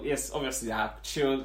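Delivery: normal talking voice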